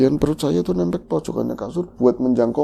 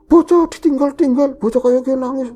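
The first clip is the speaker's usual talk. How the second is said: much higher, louder retold scolding; mother-in-law scolding the narrator, high and loud; 13 semitones higher, 7 dB louder than usual. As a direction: nag her hard for leaving the baby. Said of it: "Diomelin habis-habisan saya".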